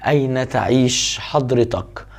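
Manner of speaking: normal talking voice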